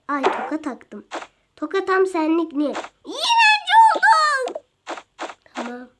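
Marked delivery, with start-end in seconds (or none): 3.0-4.6 teasing shout. Acted.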